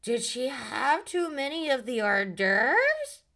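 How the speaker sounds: slow, slurred tipsy drawl; a touch of Denise slurring at the dinner; mock slurring. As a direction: imitate a drunk woman slurring her joke. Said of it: "And Denise slurs".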